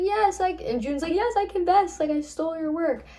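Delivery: panicked confession. Retold or imitated. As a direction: confess in a high, scared rush. June confessing to Athena's voice.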